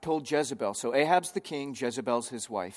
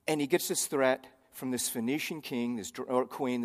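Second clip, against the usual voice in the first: usual speaking voice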